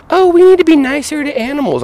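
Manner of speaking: high-pitched mock do-gooder voice; mocking animal lovers. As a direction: put on a high, naive plea. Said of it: "when people are like".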